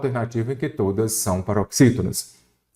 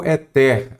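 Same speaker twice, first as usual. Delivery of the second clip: ÉTER said slowly as "e-TÉR", end stressed; stress shifted to last syllable. Shown as a demonstration. Teacher shows what the word turned into, end-stressed. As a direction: lean on TER in "éter". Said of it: "aí virou éter".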